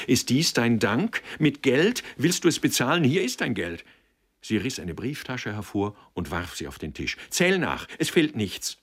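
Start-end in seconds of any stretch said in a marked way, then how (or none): 0.1-3.9 high, loud, indignant questions; bitter raised-voice outburst; outraged reproach. A narrator's reading. Mother's angry reproach, hands folded. 7.3-8.8 high, loud, sharp command; indignant raised-voice outburst; angry challenge. A narrator's reading. Throws money down in anger.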